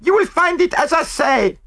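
loud, very high accusing voice; screamed. A narrator's reading. French maid insists to the other woman, screaming. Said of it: "screamed the other".

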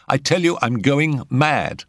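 high-pitched, dramatic insistence; insistent repeat. A narrator's reading. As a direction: press it home to get a reaction. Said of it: "he repeated it".

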